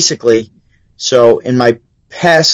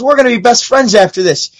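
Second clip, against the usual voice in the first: imitated stage comic; 8 semitones higher than usual. Comic addressing crowd after heckler removed.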